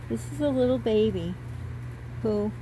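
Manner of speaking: usual speaking voice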